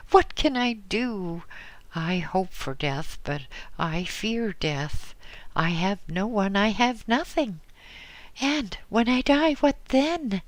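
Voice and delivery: put-on an old woman begging for an answer; frightened old widow. Widow pours out her fears.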